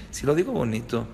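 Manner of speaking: usual speaking voice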